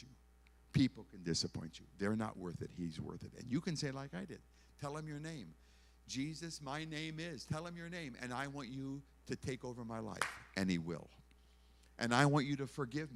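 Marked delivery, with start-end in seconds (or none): none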